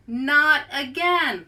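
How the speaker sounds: loud, slow exasperated cry; frustrated wail. Acted. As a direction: groan it into the trunk. Carlos fuming through trunk.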